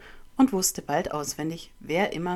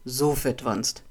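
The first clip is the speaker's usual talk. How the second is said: lower-pitched bully's insult; bullying jeer; 5 semitones lower, slower than usual. A narrator's reading. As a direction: throw it out as a low, rude jeer. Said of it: "pöbelte ihn André an". (pöbelnd, tiefer angepöbelt)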